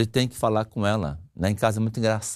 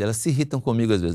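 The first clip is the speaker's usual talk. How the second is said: usual speaking voice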